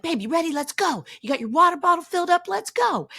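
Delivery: high, loud, excited hurry-up; rushing her husband out the door, bursting with excitement; can't wait to get to the gym; eager call. Retold or imitated.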